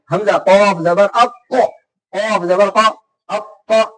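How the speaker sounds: अक spelled very slowly, the doubled क held tight before release; pressed shadda. Shown as a demonstration. Teacher drilling a child, holding the doubled क firm. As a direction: squeeze the क in अक, then let it go. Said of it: "शद वाले लफ्ज को थोड़ा सा ऐसे कस के".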